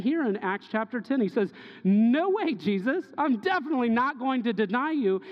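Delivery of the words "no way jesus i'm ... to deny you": high, loud, indignant no way; Peter protesting loudly. Acted. Peter insists to Jesus he will never deny him, loud protest. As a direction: refuse it like a brash disciple.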